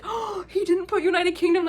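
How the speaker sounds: high, loud, drawn-out disbelief; mock shock. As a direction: imitate an outraged onlooker. Acted shocked bystander getting involved.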